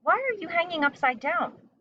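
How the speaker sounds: high, bright bat voice; curious bat. A narrator's reading. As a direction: question her like a puzzled bat. Bat voice addressing Stella.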